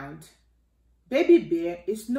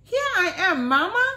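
cub's voice; 10 semitones higher, 4 dB louder, faster than usual. Acted. Baby Bear answers playfully.

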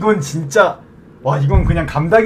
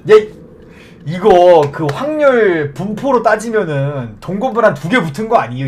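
usual speaking voice

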